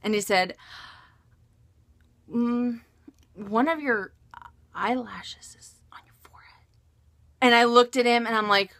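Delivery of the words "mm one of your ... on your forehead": imitating husband's reluctant, whispered admission; hesitant hushed voice. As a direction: trail down into a whisper. Husband hesitantly breaks awkward news.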